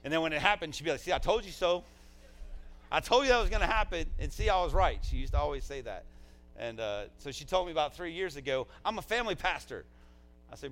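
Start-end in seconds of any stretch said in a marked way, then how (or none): 1.0-5.0 loud, high mock I-told-you-so; playful imitation, raised and insistent; retold gloating. Imitates her vindicated I-told-you-so. 8.9-9.9 higher, louder retold declaration; mock-adamant, raised voice; imitated insistence. Imitates her insisting on her title.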